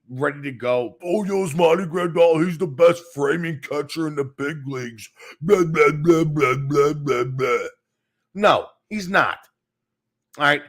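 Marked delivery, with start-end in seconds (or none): 1.0-5.1 mocking hyped fans. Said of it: "They were all hyped up and ready". 5.4-7.7 dismissive blah blahs, loud then fading; mocking blah blah. Mocking the fans' chatter.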